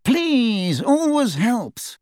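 high, drawn-out sneer; haughty, cold condescension in a high voice; cold, snide. A narrator's reading. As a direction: stretch the words out slowly and snidely. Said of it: "said a cold, snide voice".

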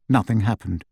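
plain reading voice

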